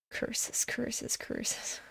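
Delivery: unvoiced hiss of "curses"; quiet whispered grumble. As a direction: breathe it like a villain.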